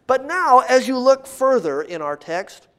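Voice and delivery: ordinary talk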